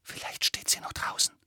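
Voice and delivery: secretive, voiceless hush; breathed whisper. A narrator's reading. Hermine fears Umbridge is outside, whispering to Harry. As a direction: whisper it close to the ear. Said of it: "hauchte sie ihm ins Ohr".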